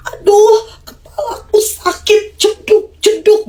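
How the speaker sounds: put-on high, tearful toddler wail; crying child. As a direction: cry like a little kid bumping its head.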